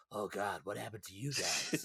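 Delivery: quietly shocked at the survivors; hushed shocked soldier. Acted. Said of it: "and goes".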